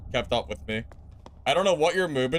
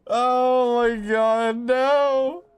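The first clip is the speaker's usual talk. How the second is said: shocked cry; 10 semitones higher, 6 dB louder, much slower than usual. Real, not acted. Dies suddenly in game.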